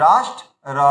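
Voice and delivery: রাষ্ট্র stopped after ট, then a separate র; split word. Shown as a demonstration. Teacher corrects the ending, রাষ্ট্র and র said apart. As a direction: pause before a short final র after রাষ্ট্র. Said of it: "এটা শুধু র হবে".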